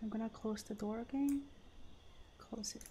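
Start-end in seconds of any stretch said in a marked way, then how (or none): 2.4-2.8 whispering. Real, not acted. Sneaking, whispering to self.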